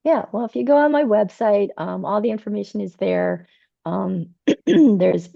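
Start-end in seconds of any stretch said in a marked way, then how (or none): 3.8-5.3 losing voice. Real, not acted. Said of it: "sorry, I'm, like, losing my voice".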